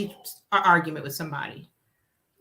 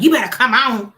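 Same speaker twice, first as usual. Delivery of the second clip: loud, bossy call; angry command; 4 semitones higher, 9 dB louder than usual. Retold or imitated. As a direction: order her to leave now. Friend storming out.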